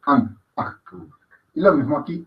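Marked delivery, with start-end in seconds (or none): 0.1-0.8 "Ang" brief and guttural, then breathy-cut "A"; short and brief. Shown as a demonstration. Teacher showing short sounds, brief "Ang" and "A". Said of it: "De sonido corto, breve".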